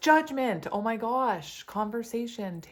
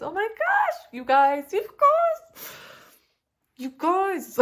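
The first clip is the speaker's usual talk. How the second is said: high, loud squealing; excited squeal; 11 semitones higher, 10 dB louder than usual. Real, not acted. Tarot reader squealing to viewers over an amazing draw, excited. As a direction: shriek it with delight.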